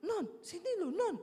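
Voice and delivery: husband's high, urgent call, acted out; imitated yelling. Startled husband calling his wife over, imitated high yelling. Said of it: "Dia teriak-teriak".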